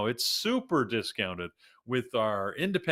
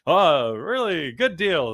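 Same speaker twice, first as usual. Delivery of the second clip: loud, slow car ad voice; cheesy car dealer pitch; car commercial; 6 semitones higher, 8 dB louder than usual. Pretend. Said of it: "one of those car commercials".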